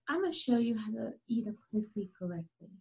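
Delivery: fast, soft whisper; hushed instruction. Retold or imitated. Showing the husband how it is done, hushed whisper. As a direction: whisper it quickly, like confiding a lesson.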